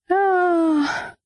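Schrei. Acted. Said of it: "Ich will nicht schreien".

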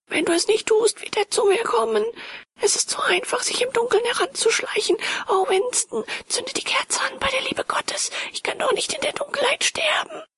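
very high, broken-voiced pleading; desperate falsetto plea, thinning towards the end; terrified begging. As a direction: beg for your life in a high, frightened voice.

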